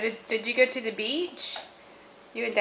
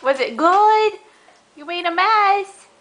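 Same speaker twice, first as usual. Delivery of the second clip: excited; 7 semitones higher, 5 dB louder, much slower than usual. Real, not acted. Playful reaction to mess.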